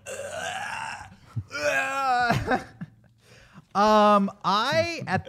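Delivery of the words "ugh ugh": creaky groan of coming back to life; strained waking-up groan; alien groan of revival. Retold or imitated. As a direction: imitate a creature groaning awake. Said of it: "come back to life like".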